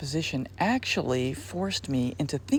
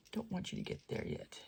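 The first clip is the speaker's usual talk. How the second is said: very soft whisper to the paint; whispered coaxing; 3 semitones higher, 12 dB quieter than usual. Real, not acted. Painter talking to the paint as it nears the edge, whispered. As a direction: whisper it as a gentle plea.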